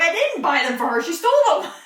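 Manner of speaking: loud, high protest, laughing; retold protest. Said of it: "I'm like".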